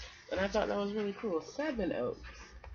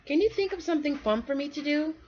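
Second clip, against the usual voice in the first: put-on pleading kid; 11 semitones higher, 5 dB louder, faster than usual. Squirrel pleads again.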